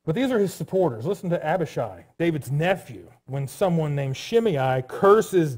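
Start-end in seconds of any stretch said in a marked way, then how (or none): none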